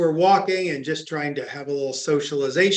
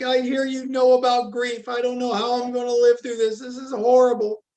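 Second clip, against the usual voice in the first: high, tearful plea, voice lifted; mock-tearful neighbor, much higher; tearful girl; 6 semitones higher, faster than usual. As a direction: imitate a young girl close to tears. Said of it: "she's in tears".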